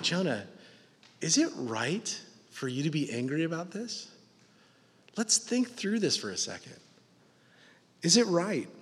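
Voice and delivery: soft, tender questioning of Jonah; gently, like an arm round his shoulder; loving. Acted. God lovingly questioning angry Jonah, soft and tender. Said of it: "lovingly putting his arm around him".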